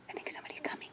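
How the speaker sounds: tense hushed whisper. Real, not acted. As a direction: warn in a whisper. Hiding from the bear.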